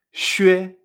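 shue said "shüe", with French U; loud, clear shue with Ü before E; X con diéresis. Shown as a demonstration. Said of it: "se van a pronunciar". (shue con U francesa)

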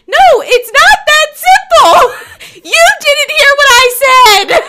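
high, loud, slow, laughing protest; frantic laughing protest. Retold or imitated. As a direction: laugh through a panicked objection. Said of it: "And I'm like".

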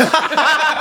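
high, loud burst of laughter. Real, not acted. After the punchline to the kid, cracking up. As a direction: break up at your own punchline.

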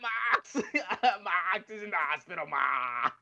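hammed-up high yell, breaking into laughs; mock Kingston calling mom. Imitating Kingston yelling for his mom from the back. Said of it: "Eddie Kingston in the back calling his mom".

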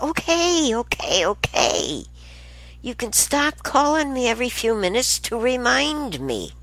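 high-pitched, fed-up acted reply; exasperated retort. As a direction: act out begging a nagging caller to stop. Acts out reply to reminder calls.

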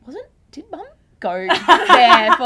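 high, loud, drawn-out teasing question; laughing, stretched-out mock curiosity; mock wondering. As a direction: bait mum with exaggerated innocence. Baiting mum about New Zealand story.

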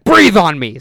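retold cop losing patience; insistent cop command. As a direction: push it out loud, as a mock bark. Cop repeats order after being questioned.

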